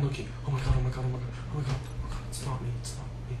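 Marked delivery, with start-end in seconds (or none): 2.3-3.4 fast, soft whispering; guilty whisper. Real, not acted. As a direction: hiss your innocence so nobody hears. Guilty hiding the body.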